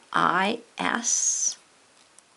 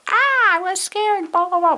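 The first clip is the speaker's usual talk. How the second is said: higher, louder, hammed-up fright; mock scared cry; 12 semitones higher, 7 dB louder than usual. Shown as a demonstration. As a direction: act out a scary moment, playfully. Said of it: "Maybe a time you were scared".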